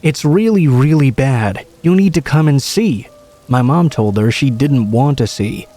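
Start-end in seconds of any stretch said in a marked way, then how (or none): 0.0-3.1 higher-pitched voice for a distressed woman; pleading woman. A narrator's reading. Woman urging family to come along, mildly frantic pleading. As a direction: plead lightly, as a narrator quoting her.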